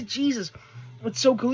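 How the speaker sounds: usual speaking voice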